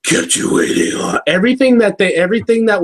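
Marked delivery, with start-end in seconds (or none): 0.0-1.2 low, voiceless growl; death metal singer. Acted. Cohost voicing the crossover's hero, death metal growl. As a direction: growl it like a gruff game hero. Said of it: "sound like it'd be said by a death metal singer".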